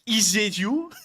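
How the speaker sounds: lightly voiced dazed soldier asking; a quizzical little put-on voice; voicing soldier. Soldier voiced meeting the drone, mildly put-on puzzled voice. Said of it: "And then he does this".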